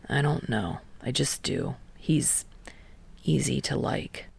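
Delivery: low, soft, a hint of a reluctant teen; grudging, quiet admission; lightly voiced Kei. A narrator's reading.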